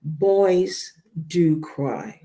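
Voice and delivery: very slow, every word weighed; a slow, deliberate reassurance to the men listening; stressed slowly. Real, not acted. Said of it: "I want to stress to men".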